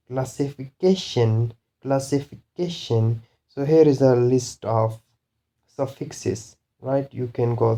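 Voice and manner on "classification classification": classification with CA stressed; stress before suffix. Shown as a demonstration. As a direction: lean on CA, just before the final -tion. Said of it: "before the suffix".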